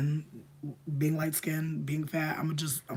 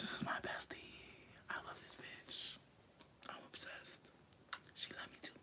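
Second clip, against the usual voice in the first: much softer, breathy whisper; whispered aside; 24 dB quieter than usual. Real, not acted. Talking about her pet bestie in a whisper. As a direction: whisper it close, fondly.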